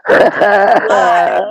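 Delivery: loud excited shout of the word. Real, not acted. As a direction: belt it out with a laugh. Shouts about filming live.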